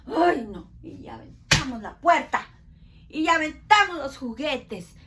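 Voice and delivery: loud hammed-up fit; mock tantrum. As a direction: storm off like a frustrated kid. Acting a tantrum.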